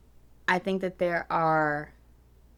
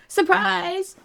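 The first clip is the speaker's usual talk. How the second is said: sarcastic surprise; 14 semitones higher, 6 dB louder than usual. Real, not acted. Sarcastic after bad news.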